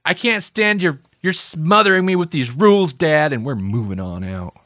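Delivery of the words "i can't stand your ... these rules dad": high, exasperated mock-teen complaint; rebellious kid to dad. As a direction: whine at dad like a fed-up teenager. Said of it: "We're like".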